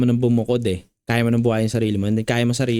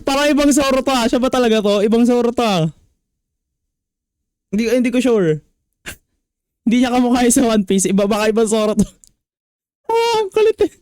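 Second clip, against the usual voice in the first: amused and excited; 9 semitones higher, 3 dB louder than usual. Real, not acted. Judge amused, reacting to bar.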